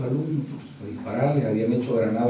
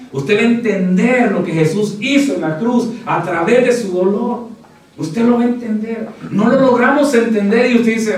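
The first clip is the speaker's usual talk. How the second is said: full-force preaching; 8 semitones higher, 8 dB louder than usual. Acted. Pastor to the congregation, full-force preaching.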